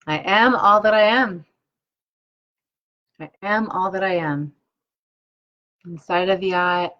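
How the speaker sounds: slow, measured affirmation chant with pauses; guided affirmations. Acted. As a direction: lead the listener through each affirmation calmly. Leads tapping affirmations aloud.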